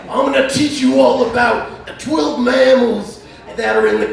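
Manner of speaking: high, strained wrestling promo voice; Macho Man Randy Savage. Acted. Wrestler calling out Hogan about canyon wildlife, hammed-up promo voice. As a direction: challenge a rival like a hammed-up pro wrestler. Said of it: "What if Macho Man Randy Savage saw the Grand Canyon?".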